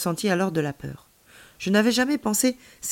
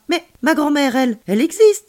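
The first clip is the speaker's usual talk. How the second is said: insistent protest; 8 semitones higher, 9 dB louder than usual. A narrator's reading. Child protests about grandmother.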